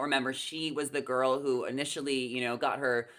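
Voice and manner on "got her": normal talking voice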